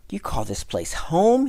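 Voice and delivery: high, loud, incredulous question; loud protest, pitched high; indignant cry. A narrator's reading. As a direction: cry out in outraged disbelief. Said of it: "he cried".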